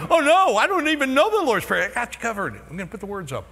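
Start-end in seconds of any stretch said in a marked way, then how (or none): 0.1-1.9 high, loud mock panic. Congregant panicking over the prayer, high loud mock alarm. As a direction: fake alarm at not knowing. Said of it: "And you've been going".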